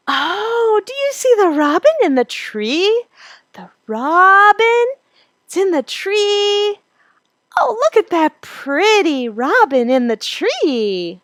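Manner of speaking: very high, sing-song happy voice with elongated vowels; parentese. Shown as a demonstration. Pointing out a robin to a baby, exaggerated happy parentese. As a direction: engage a baby joyfully, stretching out the key words. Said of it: "That's parentese".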